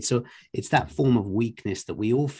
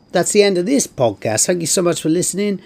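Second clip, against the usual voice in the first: loud, raised-pitch outro announcement; podcast sign-off; 7 semitones higher, 10 dB louder than usual. Real, not acted. Host thanks listeners at the show's end, loud podcast sign-off. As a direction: wrap up the show, projecting well above conversational level.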